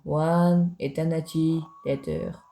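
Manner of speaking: put-on slow announcer-style English with a French accent. Gamer announcing a time skip, French-accented English. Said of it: "mon accent anglais est incroyable".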